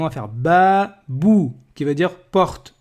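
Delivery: teacher joining the syllables "ba, bou" slowly; liaison demo. Shown as a demonstration. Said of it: "on va faire la liaison".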